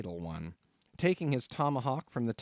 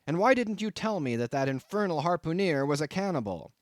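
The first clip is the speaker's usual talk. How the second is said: raised, accusing voice; indignant demand; 5 semitones higher, 4 dB louder than usual. A narrator's reading. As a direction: demand an explanation hotly. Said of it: "shouted I".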